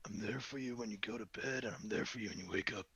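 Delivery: soft, husky sultry promo. Pretend. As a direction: purr it like a late-night radio host. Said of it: "promote yourself in a nice, sultry voice".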